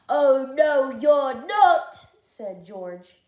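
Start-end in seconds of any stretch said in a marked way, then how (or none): 0.1-2.1 loud, high "no you're not"; bossy refusal. Acted. George refusing the girls, loud. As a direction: slam the door on them.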